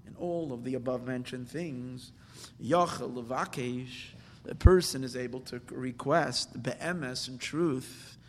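usual speaking voice

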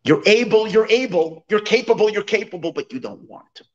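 the peasant's famous rebuke, half-shouted; retold yell. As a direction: hammer the repeats like a scolding. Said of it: "he yells at them".

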